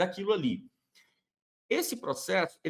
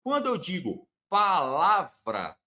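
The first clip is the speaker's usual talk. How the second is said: slow, louder demonstration of "palavra", every syllable clear; word spoken out carefully; 5 dB louder than usual. Shown as a demonstration. Teacher showing students how "palavra" sounds. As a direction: lay out "palavra" slowly and firmly for the listener. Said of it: "Agostinho dá o seguinte exemplo".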